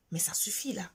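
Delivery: fed up, dropping to a hush; exasperated whisper. Real, not acted. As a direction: breathe it out, barely voiced. Soupir exaspéré soudain à voix basse. (murmure exaspéré, presque sans voix)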